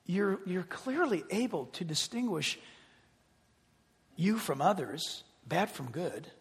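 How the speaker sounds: soft, hushed voice of God; gentle volume, measured questioning; God whispering. Acted. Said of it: "hear God whisper to you".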